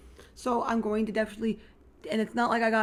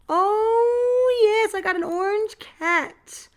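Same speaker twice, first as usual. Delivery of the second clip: higher, louder, slow thrilled gush; delighted; 10 semitones higher, 6 dB louder, much slower than usual. Real, not acted. Vlogger gushing to viewers about the orange cat, delighted. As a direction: savor the joy of the find.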